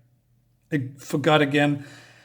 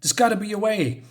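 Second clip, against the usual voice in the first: mock panicked pleading; 6 semitones higher than usual. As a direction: plead in a mild, hushed panic. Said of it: "Nomi is, is panicking".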